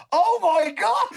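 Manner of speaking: high, amazed "Oh my God"; crowd gasping. Retold or imitated. The crowd seeing Chris Hoy's legs, high and amazed. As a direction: gasp it like a whole astonished crowd.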